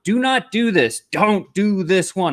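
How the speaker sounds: loud, high, slow emphatic warning. Real, not acted. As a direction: hammer home do not. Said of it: "warning against bad pitch".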